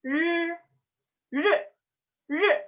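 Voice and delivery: R as a curled-tongue buzz, not a rolled er; Mandarin R. Shown as a demonstration. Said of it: "yang bisa ngomong R".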